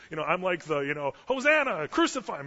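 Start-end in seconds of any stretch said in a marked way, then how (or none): 1.3-2.5 high, loud crowd chant; caught-up crowd shouting, raised pitch; crowd cry. Retold or imitated. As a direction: cry it out like one of the mob. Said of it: "it's just the crowd".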